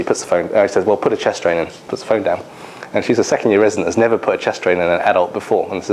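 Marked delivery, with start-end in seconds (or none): none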